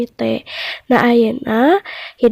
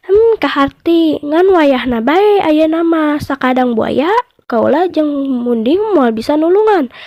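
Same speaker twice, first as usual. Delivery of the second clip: higher, knowing mouse deer voice; mouse deer's verdict; 4 semitones higher than usual. Acted. Mouse deer refuses to free the crocodile, higher knowing voice. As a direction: deliver the clever ruling calmly.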